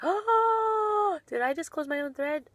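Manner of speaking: high, loud gasped oh; startled gasp. Real, not acted. Streamer finds she just closed her own thread, startled gasp. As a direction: gasp in sudden realisation.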